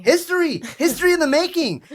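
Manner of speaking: high, loud burst of hype; hyped proclamation. Real, not acted. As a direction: proclaim it like a big headline. Hyping the show's launch.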